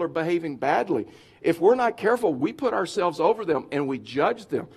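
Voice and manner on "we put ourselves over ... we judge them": normal talking voice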